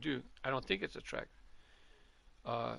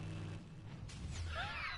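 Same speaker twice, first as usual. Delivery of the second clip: high laugh, starting breathy; real burst of laughter; 11 dB quieter than usual. Real, not acted. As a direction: burst out laughing at the silly finish. Laughs at finishing backwards.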